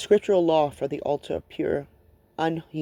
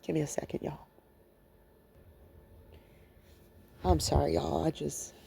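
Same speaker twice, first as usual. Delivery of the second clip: real emotion, soft and halting; apologetic and overcome, voice dropping away; emotional, needing a moment; 8 dB quieter than usual. Real, not acted. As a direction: trail off quietly, pausing to collect yourself. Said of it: "I can get very emotional".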